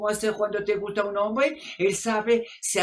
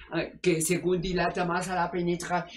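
normal talking voice

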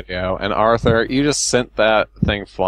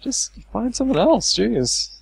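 exasperated; 11 semitones higher, slower than usual. Real, not acted. Exasperated rant about saltines.

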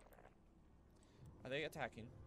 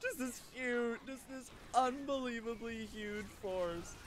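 laughing; 13 semitones higher, 8 dB louder, slower than usual. Real, not acted. Huge army appears, laughing.

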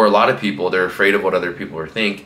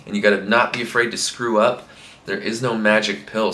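ordinary talk, nothing marked

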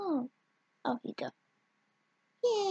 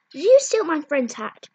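angry accusing; 2 semitones higher, 8 dB louder than usual. Acted. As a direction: yell the blame at her.

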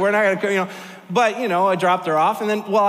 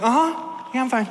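unconvincing reassurance; 6 semitones higher than usual. Retold or imitated. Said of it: "And she's like".

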